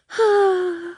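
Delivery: high wistful sigh of longing. A narrator's reading. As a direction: sigh dreamily for the old days. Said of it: "she sighed longingly".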